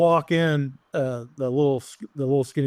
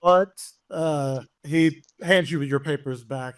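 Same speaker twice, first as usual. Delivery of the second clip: ordinary talk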